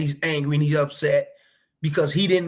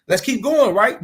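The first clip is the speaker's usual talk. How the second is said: going pushed louder and higher, falling at the end; emphatic louder repeat; 6 semitones higher, 6 dB louder than usual. Shown as a demonstration. Urging the fellas on, louder and higher. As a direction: punch it harder the second time, with going high and loud.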